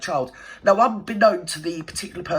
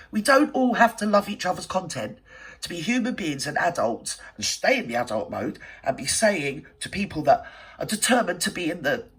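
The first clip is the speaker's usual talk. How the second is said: ordinary talk, nothing marked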